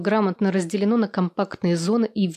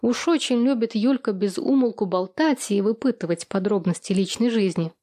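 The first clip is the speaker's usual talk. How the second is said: plain reading voice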